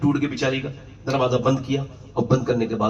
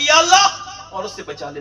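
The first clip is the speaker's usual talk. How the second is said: pleading prayer cry; 4 dB louder than usual. Retold or imitated. Man praying to be saved.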